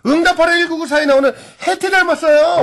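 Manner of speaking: high-pitched, lilting read-out; listener's excited message. Acted. Host reading a listener's message to a guest, high-pitched.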